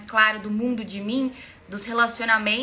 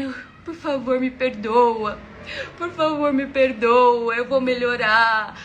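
imitating a tearful, drawn-out plea; mock sobbing; 5 dB louder, slower than usual. Ex begging forgiveness after the breakup, mock sobbing. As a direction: wail and beg like a sobbing boyfriend. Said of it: "ele chorava de soluçar".

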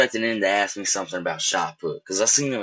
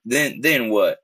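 ordinary talk

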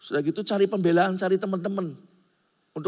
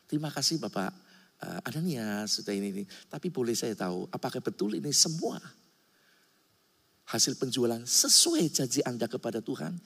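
softer, lower, gently questioning; gentle Peter; 5 semitones lower, 4 dB quieter than usual. Retold or imitated. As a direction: ask kindly, with no anger at all. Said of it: "Petrus ngomongnya lembut ternyata". (Petrus bertanya dengan lembut, lebih pelan)

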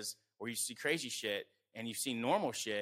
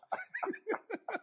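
high-pitched real giggling; helpless high laugh; laughter. Real, not acted. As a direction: burst out laughing at your own joke. Laughing at own joke.

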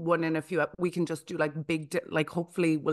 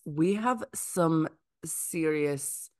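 dramatic slow build; much slower than usual. Real, not acted.

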